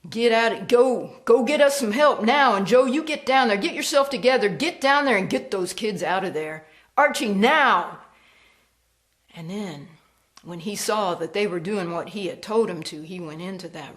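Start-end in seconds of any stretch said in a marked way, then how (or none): 0.1-8.0 loud, higher barked orders in a crisis; urgent hollering. Acted. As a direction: shout commands to get help now. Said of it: "He started hollering".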